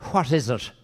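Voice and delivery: a hint of a wary poacher; startled poacher. Startled poacher answers stranger in joke.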